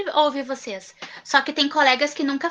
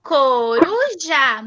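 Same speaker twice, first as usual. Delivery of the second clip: slow repeat; much slower than usual. Shown as a demonstration. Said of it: "teacher repeats word slowly".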